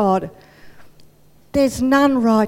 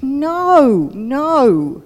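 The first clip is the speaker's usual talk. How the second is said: louder, emphatic denial; insistent protest; 2 semitones higher, 4 dB louder than usual. Acted. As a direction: push back firmly on the doubt. Paul insists to doubtful friend.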